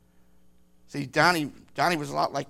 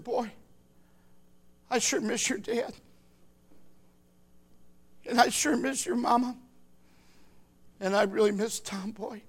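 tearful; 5 semitones higher, 4 dB quieter than usual. Retold or imitated. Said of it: "And in tears".

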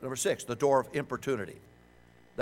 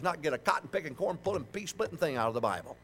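normal talking voice

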